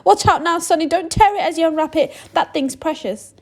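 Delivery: high, loud and thrilled; excited shopkeeper. Acted. Shopkeeper calls for newspapers, high and excited. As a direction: keep the excitement pouring out.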